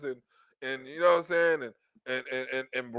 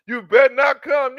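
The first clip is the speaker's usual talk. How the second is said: higher, louder sassy warning; mock threat; 7 semitones higher, 7 dB louder than usual. Woman warning the guy not to come yet, sassy. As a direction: warn him off with attitude.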